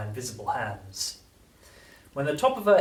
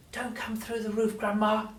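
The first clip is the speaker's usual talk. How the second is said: put-on praying boy; 10 semitones higher than usual. A narrator's reading. George praying Grandma stops, hushed plea. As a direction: beg under his breath. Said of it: "he prayed".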